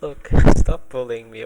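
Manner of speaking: loud, exasperated outburst; loud protest. Real, not acted. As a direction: shout it back in protest. Protesting friend's teasing.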